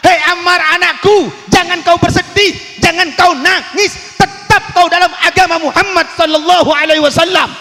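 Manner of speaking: high, loud cry of a mother to her son; mother crying out to Ammar, high and loud; mother pleading. Acted. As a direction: call out to your weeping child to hold firm. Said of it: "Emak nangale anak nangis".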